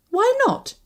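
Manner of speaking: high-pitched, put-out question; chagrined. A narrator's reading. Woman refused an explanation, chagrined. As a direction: protest it high, stung by refusal. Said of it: "I said, chagrined".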